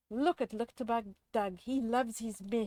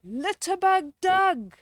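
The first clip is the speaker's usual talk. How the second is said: loud, higher heroic call; 7 semitones higher, 6 dB louder than usual. Acted. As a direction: call out to the villain like a superhero. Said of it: "said our green hero".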